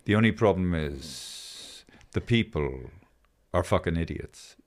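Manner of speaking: Osho. Retold or imitated. Retelling a guru's punchline to co-hosts, slow guru manner. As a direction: deliver it unhurried, imitating a guru. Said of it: "talks very slowly, takes his time".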